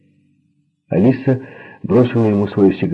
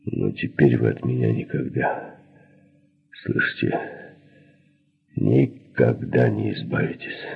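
low threat; 5 semitones lower, 4 dB quieter than usual. A narrator's reading. Man threatens the two as leaving.

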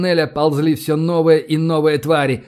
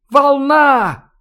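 high, loud drawn-out cry; alarm shout; 10 semitones higher, 5 dB louder than usual. A narrator's reading. Lookout sighting a wave of spiders, high alarm shout. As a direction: yell the warning far ahead.

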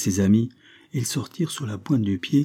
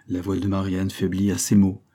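ordinary delivery

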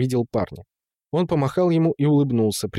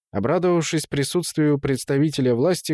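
usual speaking voice

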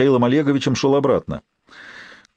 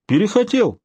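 high, sharp feigned surprise; mock surprise; 10 semitones higher than usual. A narrator's reading. Brother feigns surprise at the rejection, much higher. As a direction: leap way up as if taken aback. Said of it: "Вроде как удивился Борис".

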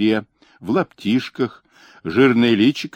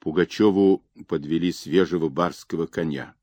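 usual speaking voice

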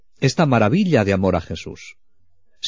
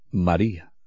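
soft, lower, loving call of her name; tender calling; 5 semitones lower, 4 dB quieter than usual. Retold or imitated. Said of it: "con aquel tono que ella tantas veces". (llamada tierna, más baja y suave)

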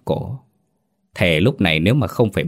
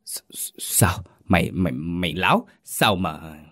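breathless stuttered whisper; stammering in shock; 3 dB quieter than usual. Acted. Master stunned by the news of the drowning, whispered stammer. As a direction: choke on the words, barely voiced. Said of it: "Lão ú ớ".

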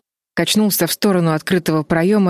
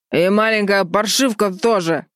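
put-on drunken slur; 4 semitones higher than usual. A narrator's reading. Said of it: "заплетающимся языком проговорил он".

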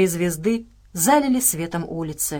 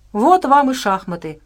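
higher, louder grumble; grumbling; 5 semitones higher, 5 dB louder than usual. A narrator's reading. Abrams remarks on Kuzu's move, grumbling. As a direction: grumble it out, raised rather than under the breath. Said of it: "проворчал Абрамс".

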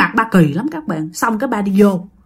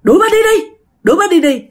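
very high, loud shouted command; husband shouting to throw her out, high and loud; retold shouting; 10 semitones higher, 4 dB louder, much faster than usual. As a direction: bark it at the stranger, insistent. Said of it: "Thì ba nói".

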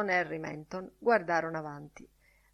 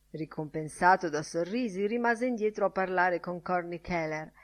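ordinary delivery, nothing marked

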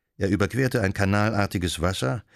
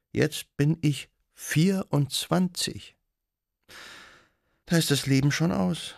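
wistful resignation; 8 semitones higher than usual. A narrator's reading. Young widow confiding in her guest that life is over, wistful. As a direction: lift it high and let the years sink in. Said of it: "Sie seufzte beschaulich".

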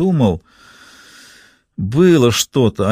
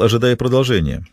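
ordinary delivery

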